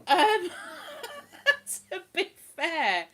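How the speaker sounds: high burst of laughter; laughing. Real, not acted. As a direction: crack up mid-word.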